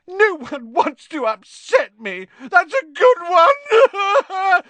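very high, slow, loud wailing; tearful howl. A narrator's reading. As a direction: howl the words back in bitter disbelief. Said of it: "howled Myrtle".

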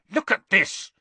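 put-on angry boy shoving a paper at a friend; snarled. A narrator's reading. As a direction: thrust it at her in fury. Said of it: "Harry snarled".

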